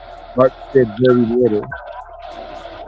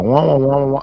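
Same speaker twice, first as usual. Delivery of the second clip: muted trombone wah wah; imitating a droning teacher voice; Charlie Brown teacher wah. Mocking his yelling wife with the wah wah of a cartoon teacher. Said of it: "you sound like Charlie Brown teacher".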